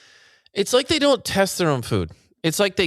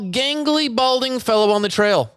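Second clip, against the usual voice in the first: high, urgent newsboy cry; mock breaking-news announcer; 8 semitones higher, slower than usual. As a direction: call out a breaking bulletin, stretching key words.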